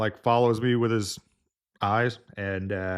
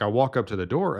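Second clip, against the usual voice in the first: ordinary delivery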